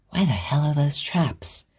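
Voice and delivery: slow, breathy whisper to herself; a hushed irritated whisper; whispered aside. A narrator's reading. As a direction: mutter it under the breath in annoyance. Muttering to herself.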